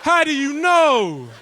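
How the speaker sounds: slow, high, loud mock indignation; mock outrage. Retelling the angry reply to his dad, mock outraged. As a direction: stretch it out, outraged.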